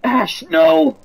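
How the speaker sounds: very loud, high scream; anguished scream. Acted. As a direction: scream as your friend dies. Character sacrifices himself.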